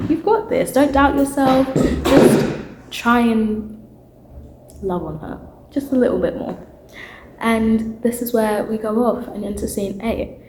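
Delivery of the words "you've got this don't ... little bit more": Genie pep talk. Retold or imitated. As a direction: pump him up to get his mojo back. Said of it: "tell him".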